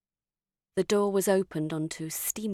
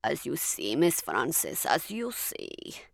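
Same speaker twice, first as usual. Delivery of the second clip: wry ill doctor; 3 semitones lower, slower than usual. A narrator's reading. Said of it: "he made a little face and said".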